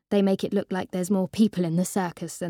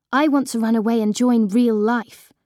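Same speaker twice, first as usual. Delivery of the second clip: louder, pointed comeback; defiant retort; 3 semitones higher, 5 dB louder than usual. Retold or imitated. Daughter snapping back at mum during argument, loud. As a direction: throw the line back at her.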